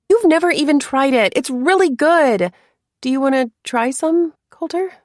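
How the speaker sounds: high, eager and hopeful. A narrator's reading. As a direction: ask brightly, hoping for yes. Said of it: "unable to hide the hope".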